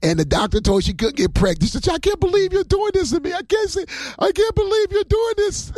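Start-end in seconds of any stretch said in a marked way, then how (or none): 1.9-5.7 high, far-off voice, half sobbing in disbelief; mock the weeping passenger, pitched way up; a hammed-up, high tearful cry of disbelief; imitating a crying woman. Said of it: "They was crying".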